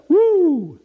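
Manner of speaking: high, drawn-out "woo"; celebratory woo. Shown as a demonstration. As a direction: let out a held, high-pitched whoop. Showing more than a mild cheer.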